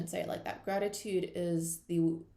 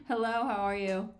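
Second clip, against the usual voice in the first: playful greeting; 3 semitones higher, 6 dB louder than usual. Pretend. Greeting money as a friend.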